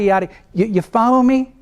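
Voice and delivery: normal talking voice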